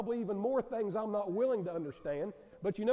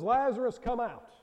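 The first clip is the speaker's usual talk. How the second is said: louder retold call into the tomb; 5 semitones higher, 6 dB louder than usual. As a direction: cry out his name, commanding. Retelling Jesus calling Lazarus out.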